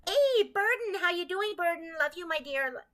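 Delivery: high, bright hello to a friend; excited greeting. Real, not acted. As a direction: call out happily to a viewer. Greeting a viewer in chat.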